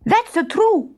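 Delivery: a high comic bandit's agreement; agreeing bandit. Acted. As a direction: chime in eagerly. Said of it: "said Luigi, nodding".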